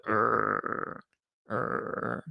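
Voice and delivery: beefy grunt. Retold or imitated. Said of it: "is more like".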